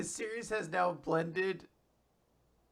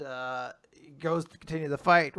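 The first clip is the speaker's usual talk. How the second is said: ordinary delivery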